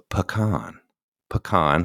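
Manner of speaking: "Pecan" as puh-KAHN, stress on the second syllable; pecan said right. Shown as a demonstration. Teaching viewers the Southern way to say it, puh-KAHN. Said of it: "let's say that word one more time".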